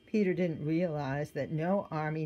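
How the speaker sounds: usual speaking voice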